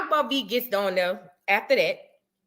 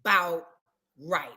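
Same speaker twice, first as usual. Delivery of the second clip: 1 dismissive; 3 semitones lower, much slower than usual. Retold or imitated. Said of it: "I said".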